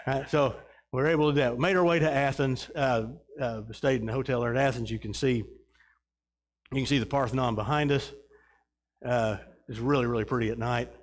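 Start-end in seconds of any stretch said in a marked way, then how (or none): none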